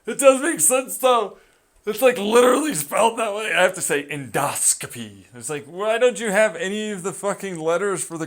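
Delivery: high, loud exasperated rant; exasperated shout. Real, not acted. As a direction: yell it in disbelief.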